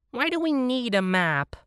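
high, drawn-up whine; whining bunny. A narrator's reading. Bunny questions the plan, whining high. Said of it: "the bunny whined".

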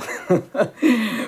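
brief amused chuckle; a polite little laugh; short polite chuckle. Acted. Solicitor laughs at misunderstanding.

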